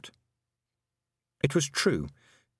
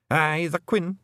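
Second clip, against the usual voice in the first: higher Scottish clan chief, wary; Rob Anybody voice; 7 semitones higher, 3 dB louder, slower than usual. A narrator's reading. Rob Anybody warily asking Tiffany, high Scottish voice. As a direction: ask it like a nervous wee chief. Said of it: "said Rob Anybody".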